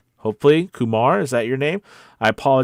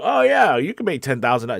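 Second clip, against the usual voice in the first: breezy, higher-pitched mock promise; mock hype; 6 semitones higher, 3 dB louder than usual. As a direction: toss it off like an easy sales pitch. Mocks hype claims in a quote.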